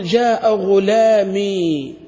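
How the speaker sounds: "جاء غلامي" drawn out very slowly; slow example. Shown as a demonstration. Teacher dictating the example to students slowly. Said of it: "كذلك عندما تقول".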